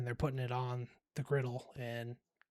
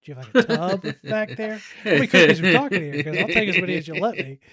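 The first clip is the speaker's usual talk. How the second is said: high, loud, eager begging; can't wait to grab every cookie; playing himself begging the cookie guy for more; greedy excitement; 5 semitones higher, 11 dB louder than usual. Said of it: "And I'm like".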